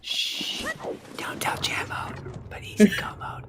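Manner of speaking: hushed conspiratorial whisper. Acted. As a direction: whisper it like a secret. Whispered aside in the bit.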